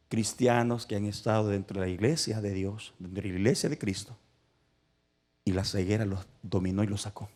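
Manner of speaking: normal talking voice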